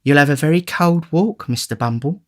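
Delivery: high, gentle concern; solicitous matron. Acted. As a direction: fuss over him kindly. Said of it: "said the matron".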